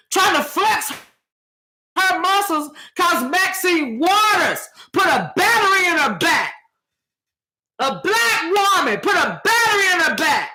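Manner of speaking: real hyped rant. Real, not acted. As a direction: preach it like a fed-up sister. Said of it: "I didn't mean to get so hyped".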